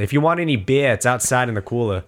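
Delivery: put-on New England accent with dropped R's; mother's New England accent. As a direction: imitate a New England mom, "beer" as "bee-ah". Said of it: "my mother has it".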